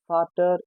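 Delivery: Vater said "FAH-ter"; V of Vater sounded as f; German pronunciation. Shown as a demonstration. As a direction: start Vater with an f. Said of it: "sounds as F".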